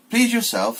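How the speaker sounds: put-on old duck snapping at the mother; dismissive old duck. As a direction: brush her off and turn away. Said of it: "said the old duck".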